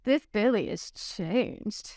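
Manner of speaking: slow mock Texas drawl; put-on slow Texas voice; mock Texas accent. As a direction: tease with a stretched Texas accent. Mocks the botched Billy accent.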